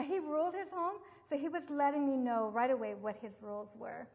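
ordinary talk

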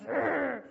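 short, loud guttural "urgh"; loud growl. Acted. Explaining the little man to a girl, loud growl. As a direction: growl out a burst of resistance. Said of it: "little man that goes".

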